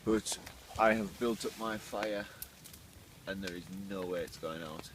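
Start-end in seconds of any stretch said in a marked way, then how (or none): none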